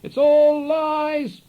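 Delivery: high, loud, drawn-out slow yell; holler. A narrator's reading. Jono hollering at punters in the arcade, a long high yell. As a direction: holler it over your shoulder at strangers, stretched high. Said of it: "he'd suddenly holler over his shoulder".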